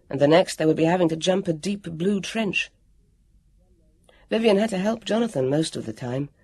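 usual speaking voice

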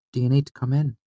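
high, light female character voice; high-pitched feminine question; young woman's voice. A narrator's reading. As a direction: offer it in a lifted woman's voice. Narrator voices Fela inviting him in.

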